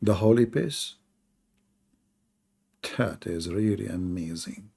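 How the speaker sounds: ordinary delivery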